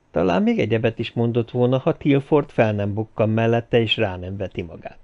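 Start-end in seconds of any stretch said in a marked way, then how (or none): none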